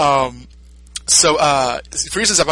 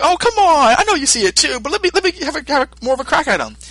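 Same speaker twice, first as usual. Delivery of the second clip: higher, eager pleading; eager Goku; 11 semitones higher than usual. Retold or imitated. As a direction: beg like a kid for one more turn.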